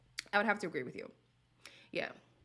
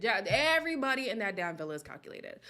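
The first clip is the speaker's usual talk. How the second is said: usual speaking voice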